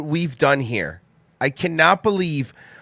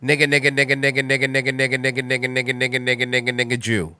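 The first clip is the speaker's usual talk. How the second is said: flat robotic readout, word by word; 4 semitones lower, 4 dB quieter, faster than usual. Shown as a demonstration. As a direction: read it out like a machine. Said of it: "I will read it like a robot".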